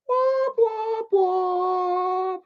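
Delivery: very high, sung-out womp womp; sad trombone womp womp. Acted. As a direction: hum a mock failure jingle. Mocking low video view count.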